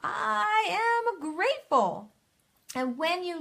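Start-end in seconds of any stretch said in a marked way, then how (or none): 0.0-2.1 much higher and slow, drawn out; declared aloud. Shown as a demonstration. Coach demonstrating to viewers, high slow proclamation. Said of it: "You are grateful for the skin".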